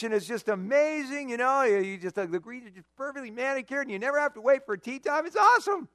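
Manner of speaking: loud, gushing enthusiasm; excited ghost buddy. Acted. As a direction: sell heaven's golf with glee. Ghost buddy tells good news.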